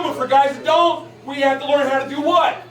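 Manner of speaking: normal talking voice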